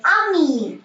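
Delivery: आमी with stress on the last syllable; French teacher model word; French ami. Shown as a demonstration. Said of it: "That is first".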